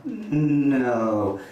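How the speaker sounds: low, dismissive no; a curt deadpan no; flat deadpan no. Acted. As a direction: dismiss her in one word. Acted rejection of second girl.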